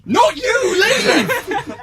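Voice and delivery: high squealing laughter and protest; startled laughing outrage. Acted. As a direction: shriek it in giggling alarm. Hero startled lawyer still there.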